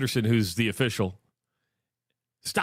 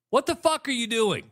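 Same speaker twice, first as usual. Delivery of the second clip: high-pitched imitated curse at a player; mock angry yell; 9 semitones higher than usual. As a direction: mimic the coach yelling in disbelief.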